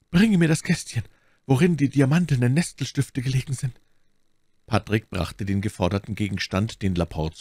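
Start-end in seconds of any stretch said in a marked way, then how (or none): 0.1-3.8 high, strained deathbed voice; dying duke, requesting. A narrator's reading. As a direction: ask urgently from the sickbed, pitched high. Dying duke orders servant. (sterbender Herzog, bittend)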